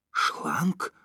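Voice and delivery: appalled one-word question, rising; horrified. A narrator's reading. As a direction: echo it in mild dismay. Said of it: "спросил Женя с ужасом".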